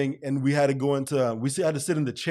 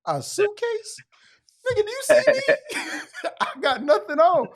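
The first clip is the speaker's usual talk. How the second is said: much higher, louder mock disbelief; incredulous squeal; 4 dB louder than usual. To the cellmate asking about a suitcase, high laughing disbelief.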